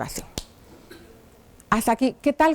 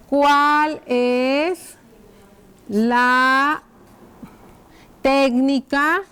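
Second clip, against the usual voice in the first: stretched words, pauses between them; slow dictation; much slower than usual. Shown as a demonstration. Teacher writing the brushing question on the board, slow dictation. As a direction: dictate it word by word as you write. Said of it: "voy a escribir cuál".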